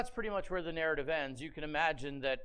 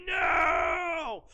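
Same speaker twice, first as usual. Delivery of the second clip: loud, higher anguished shout; big manly cry; 12 semitones higher, 9 dB louder than usual. Acted. Esau crying out to his father, anguished shout. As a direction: bellow it like a burly man in pain. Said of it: "he cries out in this big, burly, manly".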